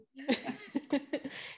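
soft high laugh that trails off; a shy, pleased laugh; real laugh after the thanks. Real, not acted.

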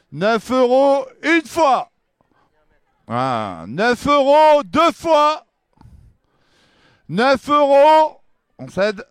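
slow, loud going once, twice, sold; auctioneer. Acted. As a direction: draw out the final call.

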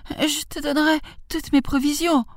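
high-pitched begging; frightened old lady's high plea; pleading grandmother. A narrator's reading. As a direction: bargain desperately in a high granny voice. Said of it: "supplia-t-elle".

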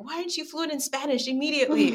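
mock-scold yourself for not being fluent yet; imitating her own harsh inner critic; self-scolding inner voice. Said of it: "I beat up on myself a lot".

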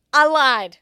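loud, punched retold confession; acted defiant confession. Woman turning on her partner mid-fight, loud confession. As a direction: blurt it out in a mock fight.

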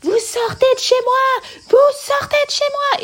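very high, nasal mock shriek; hurlement nasillard aigu. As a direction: scream the order in a piercing, nasal voice. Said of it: "j'avais une voix nasillarde, aiguë, je lui hurlais". (hurlement suraigu et nasillard rejoué)

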